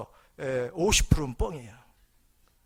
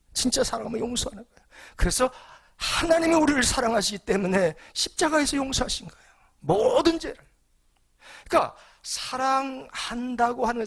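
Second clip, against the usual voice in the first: loud, high, emphatic sermon delivery; rising fervent preacher's call; preaching at full force; 8 semitones higher, 7 dB louder than usual. Acted. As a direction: preach it out to the whole congregation. Preacher driving home his point.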